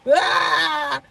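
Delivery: high, loud short scream; release scream. Shown as a demonstration. Telling listeners how to release, a loud high scream. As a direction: scream it out without caring who hears. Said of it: "You're just like".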